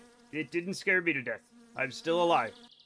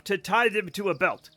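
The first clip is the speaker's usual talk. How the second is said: usual speaking voice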